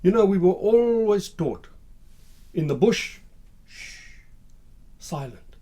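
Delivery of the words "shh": soft, drawn-out shush; hushing shh. Acted. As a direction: hush them quietly. Taught silence in the bush.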